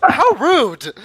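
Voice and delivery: high, loud, indignant exclamation; mock offence. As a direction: act scandalized, pitch swooping up. Character takes mock offence, players laughing.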